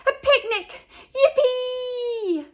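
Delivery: put-on very high, laughing kid cheer; excited cheering. A narrator's reading. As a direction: burst with glee. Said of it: "everyone shouted".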